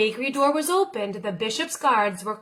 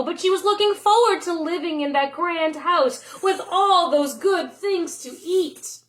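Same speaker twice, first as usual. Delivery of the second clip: ordinary delivery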